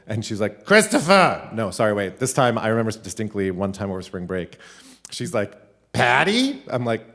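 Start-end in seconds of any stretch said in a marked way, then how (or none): 0.6-1.5 high, loud holler down the phone; hard-of-hearing grandma. Retold or imitated. Grandma calling grandson on the landline, loud high holler. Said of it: "this is how she talked". 5.9-6.6 loud, high questioning holler; hard-of-hearing grandma. Retold or imitated. Grandma mistaking grandson for his mother, loud high query. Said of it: "this is how she talked".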